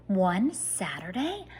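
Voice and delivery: usual speaking voice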